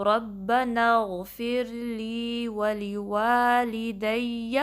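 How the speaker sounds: slow, drawn-out tajweed chant; measured Quranic recitation with long held vowels. Shown as a demonstration. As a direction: recite it slowly and melodically, stretching every vowel. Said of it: "ٹو count stretch کرنا ہے".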